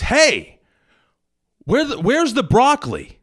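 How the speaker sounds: loud, high-pitched confrontational customer; pushy shopper calling out loudly; insistent demand. Acted. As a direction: raise your voice and demand an answer. Joke, woman demands a third time.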